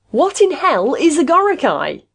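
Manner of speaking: high, loud, drawn-out roar. A narrator's reading. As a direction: roar the question in fed-up fury. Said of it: "Caswell roared".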